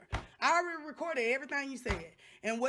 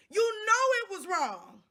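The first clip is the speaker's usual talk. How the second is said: accusing; 5 semitones higher, 5 dB louder than usual. Real, not acted. Accusing the manager.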